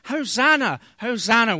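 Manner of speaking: loud, high cry of Hosanna; cheering welcome to the king; crowd acclaim. Retold or imitated. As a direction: hail him like a cheering crowd.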